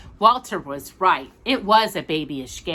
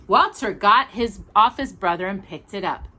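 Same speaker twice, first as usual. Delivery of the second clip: usual speaking voice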